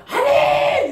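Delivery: very high, drawn-out amen cry; loud zealous amen. Acted. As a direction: parody an over-eager congregation. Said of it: "목소리 크게 따라서 믿음이".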